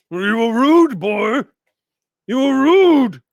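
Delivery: a huge ancient mountain, high and loud, drawn out; slow booming mountain spirit. Acted. The mountain spirit catches Ameep sneaking past, slow and booming. Whispering Man scolds.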